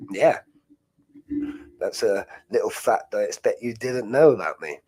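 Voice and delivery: normal talking voice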